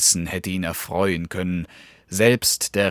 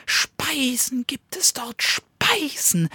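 high, secretive whisper; tempting whisper. Acted. Little dog whispers to the wolf about food. Dog whets the wolf's appetite.

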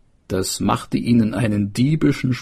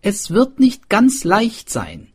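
swineherd; 12 semitones higher, 6 dB louder than usual. A narrator's reading. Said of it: "antwortete der Schweinehirt".

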